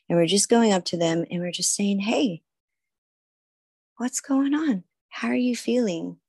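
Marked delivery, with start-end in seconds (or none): 2.0-6.2 put-on approach an upset little kid gently, voice lifted; gentle, to a child. Going up to a kid having a tantrum, gentle and higher.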